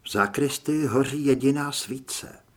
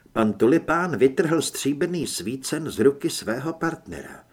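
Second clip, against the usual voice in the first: usual speaking voice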